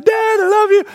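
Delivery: put-on high, sweet child voice; imitating youngest son's hug; little boy. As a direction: say it like a small boy hugging Dad. Youngest son hugging father.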